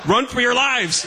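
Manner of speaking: high, loud cry of alarm; mock panicked shout. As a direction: yell a hammed-up warning to the whole room. Boy fleeing shouts to waiting room.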